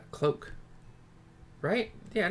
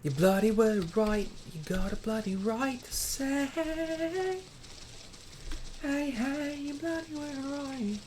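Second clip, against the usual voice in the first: British character voice; 12 semitones higher than usual. Acted. Streamer testing a British voice for a character, higher. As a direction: try out a cheeky British bloke.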